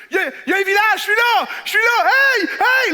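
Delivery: high, loud calls for help; desperate shouting. Pretend. Lone survivor calling to a village, shouting. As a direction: yell across to the village. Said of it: "je ferais comme".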